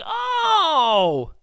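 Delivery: high, loud "oh, duh!" remembering; mock realization. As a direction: blurt it like you suddenly remembered. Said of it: "and you were like".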